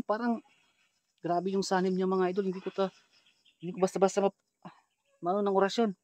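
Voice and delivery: soft, confiding commentary; hushed aside. Real, not acted. Vlogger tells viewers how bad the possession is, hushed aside. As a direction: drop the volume and confide in viewers.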